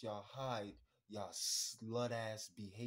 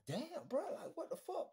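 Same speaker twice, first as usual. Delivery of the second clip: much higher, laughing through it; laughing disbelief. Retold or imitated. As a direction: laugh it off in shock. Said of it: "I'd have just been like".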